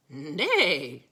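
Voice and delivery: whinny it like a horse; mock neigh. A narrator's reading.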